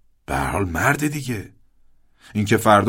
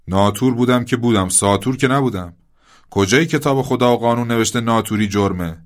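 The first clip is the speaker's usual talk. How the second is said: louder, rising indignant rant that speeds up; angry tirade; 3 semitones higher, 5 dB louder than usual. Acted. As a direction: fire it back at the cheeky boy, building to a near shout. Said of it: "براق و عصبی شد".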